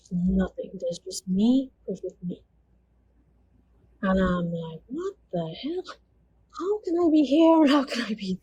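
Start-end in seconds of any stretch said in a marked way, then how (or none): 4.9-8.4 high, bewildered questions with a laugh; astonished to find herself floating; stunned disbelief. Retold or imitated. Out of her body, she asks in high, laughing disbelief.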